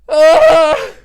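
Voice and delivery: a sudden scream, very high and loud; ecstatic scream. Real, not acted. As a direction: let out a burst of a fan scream. Overwhelmed by the scene.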